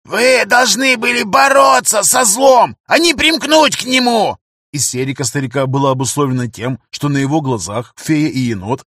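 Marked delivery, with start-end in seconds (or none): 0.0-4.4 high, slow furious shout; roaring like a wounded bear. Acted. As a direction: howl it out in rage. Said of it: "ревёт, как раненый медведь". (рёв раненого медведя)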